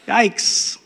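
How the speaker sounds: ordinary talk, nothing marked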